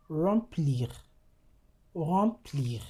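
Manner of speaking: Remplir with nasal vowel, m silent; French nasal example. Shown as a demonstration. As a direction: model remplir twice. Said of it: "You have letters".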